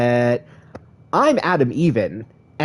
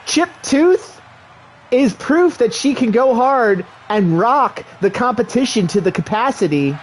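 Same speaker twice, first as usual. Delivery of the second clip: excited wrestling announcer; play-by-play commentary; 6 semitones higher than usual. Acted. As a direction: call the match like a ringside commentator.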